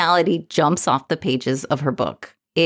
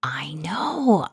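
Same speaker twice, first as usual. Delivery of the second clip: whispering; 5 dB quieter than usual. Real, not acted. Shared surprise, dropped voice.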